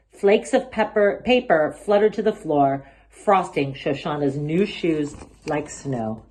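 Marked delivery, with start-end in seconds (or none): none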